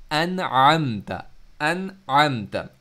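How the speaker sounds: अनअमत slow, the first अ stopped, the ain circling; slow tajweed अनअमत; hamza then ain. Shown as a demonstration. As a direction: jump from stop to throat in अनअमत. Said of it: "इसी एक लफ्ज़ में".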